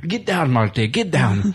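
thick, hammed-up Sheik accent, commanding; Iron Sheik impression. Sheik answering Marty in his room, mock Sheik accent. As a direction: order him in a badly faked Sheik accent. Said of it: "I have a terrible Sheik impersonation".

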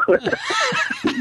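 squeaky burst of laughter; a high, breathy giggle; high squealing laugh. Real, not acted. Laughing at own joke.

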